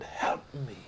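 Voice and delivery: whispered plea with the voice gone; a shout with no voice left, only breath; hoarse whisper. Retold or imitated. Exhausted man in the hole begs, whispering. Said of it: "and he shouted".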